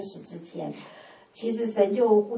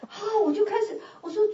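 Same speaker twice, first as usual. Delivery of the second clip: ordinary delivery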